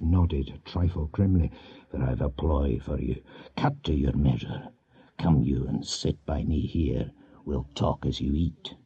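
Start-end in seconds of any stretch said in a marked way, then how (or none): 1.9-8.7 put-on a lower voice for the grim old Earl; old Scottish chief. A narrator's reading. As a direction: speak down low as a seasoned lord giving orders. Huntly's voice, low and gruff.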